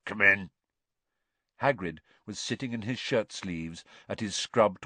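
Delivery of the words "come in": mild growl; gruff, lightly growled call. A narrator's reading. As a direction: growl it from behind a door. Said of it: "a voice growled".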